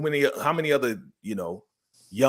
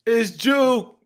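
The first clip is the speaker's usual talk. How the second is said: loud, high cheer; excited greeting; 8 semitones higher, 8 dB louder, much slower than usual. Acted. Greeting Juke back at the house, loud excitement. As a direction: hail a returning friend, hammed-up and high.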